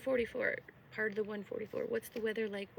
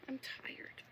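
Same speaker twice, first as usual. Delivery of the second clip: whisper; 10 dB quieter than usual. Real, not acted. Tired aside.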